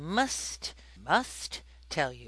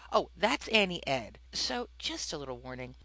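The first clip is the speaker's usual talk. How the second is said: ordinary delivery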